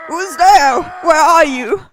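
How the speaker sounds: loud, alarmed calling out. Acted. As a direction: call out to someone unseen. Calling out to hidden voice.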